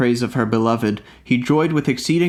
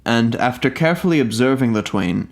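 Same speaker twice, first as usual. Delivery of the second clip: ordinary delivery, nothing marked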